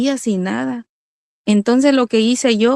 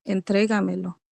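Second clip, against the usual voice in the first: soft, hushed voice of the Lord, much softer; quiet divine voice; 2 semitones lower, 7 dB quieter than usual. Retold or imitated. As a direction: let the words fall softly, like a voice heard in silence. Voz del Señor que le habla. (voz suave y baja del Señor)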